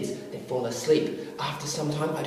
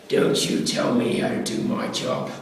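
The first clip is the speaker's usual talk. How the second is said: slow, low, breathy threat; menacing; 6 semitones lower, slower than usual. Retold or imitated. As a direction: lean in and warn him coldly. Dennis stands up threatening.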